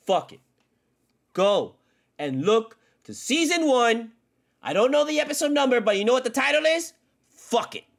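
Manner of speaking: loud, high ranting shout; angry yell. Real, not acted. Fan yelling about his team, furious shout.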